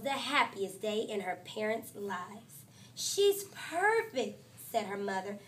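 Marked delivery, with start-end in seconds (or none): 2.9-4.4 mother's delight. Acted. Mother admiring newborn baby.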